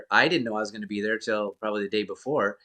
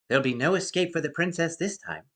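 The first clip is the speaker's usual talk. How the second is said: put-on higher-pitched English-accented droid; fretful protocol droid, lightly pitched up; C-3PO; 6 semitones higher than usual. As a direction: recite it as a worried prissy robot. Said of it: "first line of C three P O".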